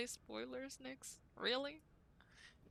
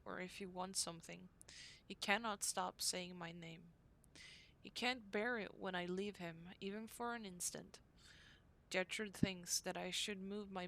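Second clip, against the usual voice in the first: diary reading; 4 dB quieter than usual. Acted. Reads diary entry from game.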